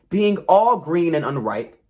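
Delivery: plain reading voice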